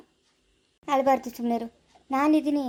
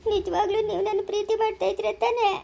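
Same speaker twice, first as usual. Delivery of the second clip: tearful doubt; 7 semitones higher than usual. Acted. Wife doubts husband's love, tearful.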